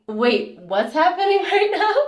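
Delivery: high, disbelieving and laughing; wait, what is going on here; amused shock at being told off; baffled inner voice. Retold or imitated. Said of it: "And so I'm thinking".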